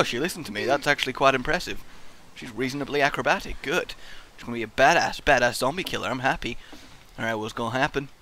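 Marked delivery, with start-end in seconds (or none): none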